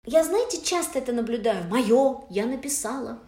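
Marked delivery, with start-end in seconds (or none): none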